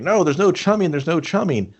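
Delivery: a light put-on panic to stop her; mock protest. Schneider's character stopping her chumming, lightly voiced protest.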